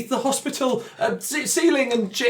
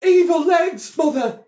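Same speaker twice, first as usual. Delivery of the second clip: mock shock; 7 semitones higher, 4 dB louder, slower than usual. Shocked doctor.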